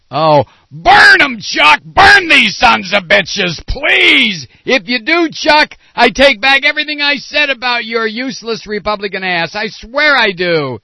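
over-the-top shouting at Chuck; mock rant. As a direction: yell it like a fired-up fan egging him on. Ranting at Senator Grassley.

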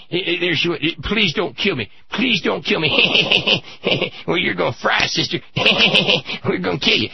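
high, giggling mockery of a condemned woman's plea; George W. Bush mocking. Governor making sport of a condemned woman to friends, giggling mockery. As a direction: cruel taunt in a squeaky, cackling voice. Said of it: "made fun of her".

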